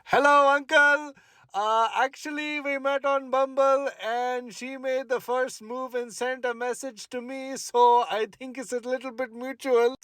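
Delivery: high, eager, nervous explaining. Retold or imitated. Nervous date explaining to the girl's father, high-pitched. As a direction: pitch up nervously to please him. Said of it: "And I'm like".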